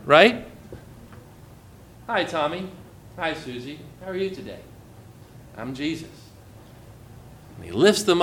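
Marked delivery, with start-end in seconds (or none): 2.1-6.1 put-on much softer, tender hello to a small child; gentle greeting. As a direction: speak softly as if bent down to a toddler.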